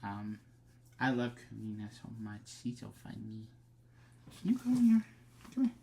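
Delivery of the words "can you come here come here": high sing-song call to the dog; sweet pet-talk call. Real, not acted. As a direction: coax the puppy over, way up high. Calling her dog over.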